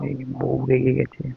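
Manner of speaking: very soft, low, slow aside; hushed aside. Real, not acted. Husband confiding to viewers that his wife is upset, hushed. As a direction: murmur it so only the camera hears.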